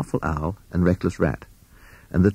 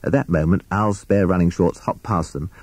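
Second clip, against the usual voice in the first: ordinary delivery, nothing marked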